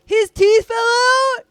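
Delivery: shrieking in fright; panicked shout. Real, not acted. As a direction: yell it in real panic. Horror game jump scare.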